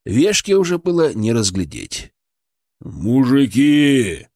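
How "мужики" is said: long, drawn-out booming call; mighty bass call. A narrator's reading. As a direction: boom it out slowly into the dark. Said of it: "раздался в темноте мощный бас Харитона".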